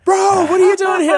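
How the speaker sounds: high, loud yell of delighted surprise; excited shouted greeting. Retold or imitated. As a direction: shout it at a friend you didn't expect. Acted excited reunion with friend.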